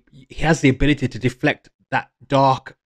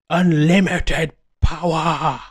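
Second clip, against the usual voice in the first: drawn-out, higher-pitched mock villain cry; Palpatine; 4 semitones higher, slower than usual. Retelling the film's villain getting his second wind, mock excited shout. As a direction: stretch it out like an excited bad guy, without real volume. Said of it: "excitedly shouting".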